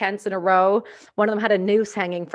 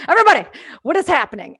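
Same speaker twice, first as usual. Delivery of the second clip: higher, louder, fast panicked cry; mock panicked American; 7 semitones higher, 8 dB louder, faster than usual. American soldiers panicking over a woman on base, mock panic. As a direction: play the flustered soldier. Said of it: "they're like".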